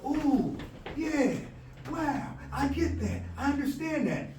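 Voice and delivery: light up as it clicks; excited realization. Acted. Student grasping the lesson, high and delighted.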